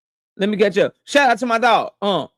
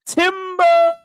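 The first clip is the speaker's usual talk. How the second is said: higher, mock shout of timber; mock call of timber; 9 semitones higher than usual. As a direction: call it like a tree is falling. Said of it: "She said".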